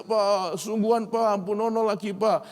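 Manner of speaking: high whiny begging; pleading child. Retold or imitated. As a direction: plead like a clinging little boy. Son clings to father's leg.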